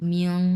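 "মিয়ং" said as "mi-ong", with an o glide; correct pronunciation demo. Shown as a demonstration. Teacher joining the sounds for students, careful model "মিয়ং" with an o glide. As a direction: join m and "ইয়ং" into "মিয়ং". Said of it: "কি উচ্চারণ হবে এটা".

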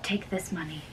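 secret whisper. Acted. Romeo whispering secret plans.

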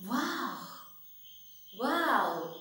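softly acted amazed wow; mild, put-on wonder; demonstrated surprise. Shown as a demonstration. Teacher showing children surprise to copy, gently mock-amazed. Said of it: "All of you do it".

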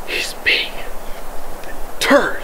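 sneaking a whispered remark about the dog; slow whisper. Real, not acted. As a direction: whisper it conspiratorially, very slowly. Whispered aside.

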